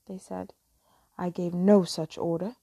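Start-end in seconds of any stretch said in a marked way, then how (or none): 1.2-2.5 low, loud captain's outburst; indignant cry. A narrator's reading. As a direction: thunder it low at the disobedient crew. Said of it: "Hook cried".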